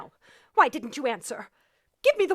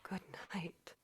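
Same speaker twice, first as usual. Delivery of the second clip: faint whispered reply; stunned whisper; 12 semitones lower, 26 dB quieter than usual. Acted. Shocked wife whispers to the telegraph clerk. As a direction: barely breathe the words.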